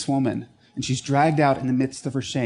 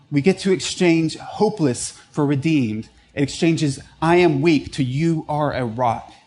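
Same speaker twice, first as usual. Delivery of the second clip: louder, driving sermon delivery; preaching at full force; 6 dB louder than usual. Acted. Pastor urging the congregation toward God's mercy, full force. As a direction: preach it out with conviction.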